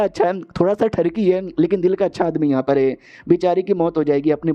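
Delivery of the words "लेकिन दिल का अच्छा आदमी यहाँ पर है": ordinary delivery, nothing marked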